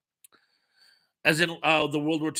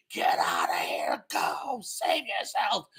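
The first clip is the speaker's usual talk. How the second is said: whispered farewell of a dying soldier; 3 semitones higher, 4 dB quieter, slower than usual. Retold or imitated. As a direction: breathe it out as a last whisper. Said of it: "and Knox is like".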